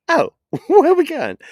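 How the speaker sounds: high, loud mock-surprised question, laughing; imitated surprise. Retelling her reply to the trip, mock-surprised. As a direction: squeal it like a surprised partner, amused.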